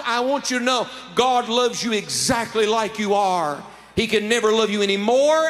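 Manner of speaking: fervent altar-call preaching; preaching at full force. Acted. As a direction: press the love of God on the crowd. Preaches the gospel appeal.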